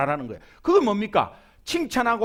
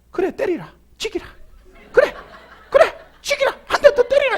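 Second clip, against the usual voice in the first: high, loud taunting Busan wife; defiant Busan wife; 13 semitones higher, 3 dB louder than usual. Retold or imitated. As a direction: dare him to hit again, shrilly. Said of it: "이럽니다, 여러분". (남편에게 대드는 부산 아내의 높은 도발)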